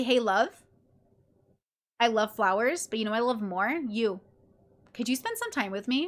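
tender request. Acted. Models a loving request to partner.

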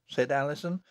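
ordinary delivery, nothing marked

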